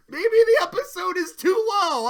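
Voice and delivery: ordinary talk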